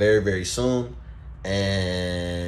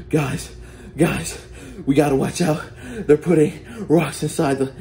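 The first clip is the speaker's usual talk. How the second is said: urgent warning; 5 semitones higher than usual. Retold or imitated. Warning friends in snow fight.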